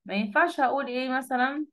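usual speaking voice

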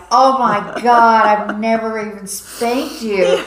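slow, loud, mortified protest; aghast mom. Retold or imitated. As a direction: drag it out in disbelief. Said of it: "And it's like".

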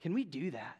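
quietly proposing a pact to a buddy; quiet retold invitation. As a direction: lean in and ask it gently, like a friend. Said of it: "And he's like".

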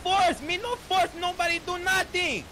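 real angry yell at the officer. Real, not acted. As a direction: push back loudly, indignant. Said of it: "why are you yelling".

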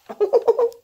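short high giggle; high burst of laughter. Real, not acted. Laugh alone.

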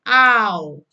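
loud Au, jaw dropping then closing; model au. Shown as a demonstration. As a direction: say Au clearly. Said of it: "simbol seperti ini dibaca". (contoh bunyi Au)

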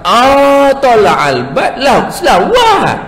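amused high grandma, laughing through it; grandma laughing at grandpa's mistake. Retold or imitated. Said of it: "nenek kata".

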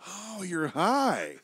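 high, drawn-out realization; knowing realization. Retold or imitated. Friend spots that he is high, drawn-out knowing tease. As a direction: stretch it out with a high swoop. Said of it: "He goes".

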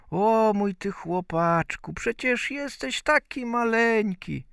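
high-pitched fussing mother voice; doting mother. A narrator's reading. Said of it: "a żona zamierzała zanieść mu obiad". (czuła mateńka, wysokim głosem)

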